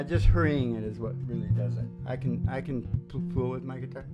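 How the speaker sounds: ordinary talk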